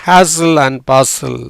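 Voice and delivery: said at half speed, each word drawn out; slow and careful. Shown as a demonstration. Teacher slowly models hazel Basil for students. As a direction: spell it out slowly for learners. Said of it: "Basil அப்படின்னு சொல்லுவோம்".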